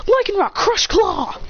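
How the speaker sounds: high, stretched-out battle call; attack command. Acted. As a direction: draw out the move's name high. Trainer shouts attack command.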